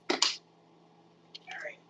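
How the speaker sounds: soft breathy whisper to herself; real quiet whisper. Real, not acted. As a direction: whisper it under your breath. Quiet aside while cooking.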